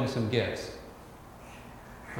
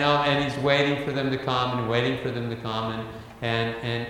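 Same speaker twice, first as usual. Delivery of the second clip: ordinary talk, nothing marked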